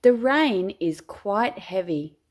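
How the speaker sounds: ordinary delivery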